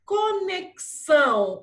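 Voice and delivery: "Conexão" high, loud and slow, ko-nek-são. Shown as a demonstration. Teacher pointing pupils to the sound, "Conexão" with clear ks. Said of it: "Ó o sonzinho".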